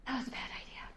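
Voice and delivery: breathless whisper after jumping; whispered aside. Real, not acted. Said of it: "I'm out of breath".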